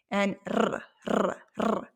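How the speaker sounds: trilled R sounds; rolled R demo. Shown as a demonstration. Teacher showing students how to trill R. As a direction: trill the r with the tongue behind the front teeth. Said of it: "and you try and roll it".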